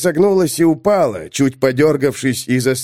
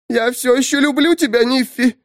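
put-on high, thin whining dead girl; high petulant undead complaint; whiny undead girl; 12 semitones higher than usual. A narrator's reading. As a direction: whimper like a sulky corpse. Dead girl declaring love.

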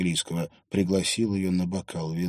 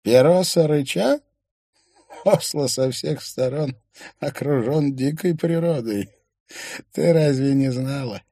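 put-on higher, louder old man's voice, questioning; old man character; 8 semitones higher, 6 dB louder than usual. A narrator's reading. Old man informs a younger woman about wild nature, old man character. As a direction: voice the old man, raised and emphatic.

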